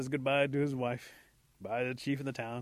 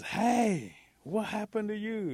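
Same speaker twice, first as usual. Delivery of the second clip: high, raised indignant call; 10 semitones higher, 5 dB louder, slower than usual. Acted. Possum finds Rabbit with a pretty girl, indignant. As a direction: confront a friend who left you out.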